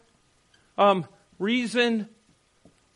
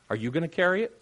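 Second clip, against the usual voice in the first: usual speaking voice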